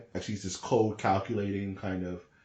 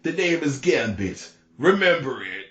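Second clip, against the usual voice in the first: slow, louder and higher cartoon hero voice; Gambit line; 5 semitones higher, 6 dB louder than usual. Quoting Gambit's line to Apocalypse, slow and louder. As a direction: deliver it like a cocky comic-book hero. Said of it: "and Gambit says".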